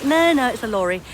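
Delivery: ordinary delivery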